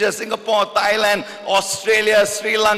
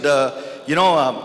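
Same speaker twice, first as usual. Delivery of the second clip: normal talking voice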